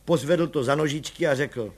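ordinary delivery

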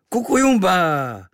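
high, loud, drawn-out yell; sudden shriek. A narrator's reading. Native erupting out of nowhere, shrieking. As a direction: shriek it slowly, falling at the end. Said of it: "Pak z ničeho nic zaječel".

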